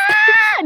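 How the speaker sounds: very high, loud squeal of delight; delighted shriek. Real, not acted. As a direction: shriek with glee. Reacting to the persona voice.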